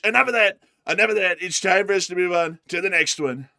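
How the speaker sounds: lightly done boastful Trump voice, brash and pleased; Trump parody. Acted.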